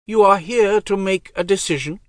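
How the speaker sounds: high, cold divine voice; goddess voice. Acted. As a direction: speak as an aloof goddess. Goddess speaks.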